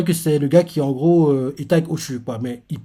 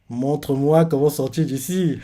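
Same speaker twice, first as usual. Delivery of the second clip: sly aside. Retold or imitated. Reader reveals the schemer behind the plea, slyly. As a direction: drop the passion, say it slowly. Repeats the line as real intent.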